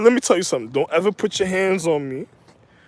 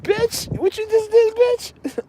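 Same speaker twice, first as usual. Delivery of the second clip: squeaky mock confrontation; 14 semitones higher, slower than usual. Acted confrontation.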